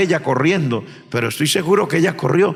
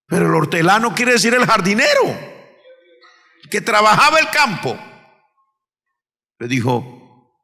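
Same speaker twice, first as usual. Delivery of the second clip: normal talking voice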